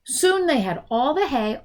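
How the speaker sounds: ordinary delivery, nothing marked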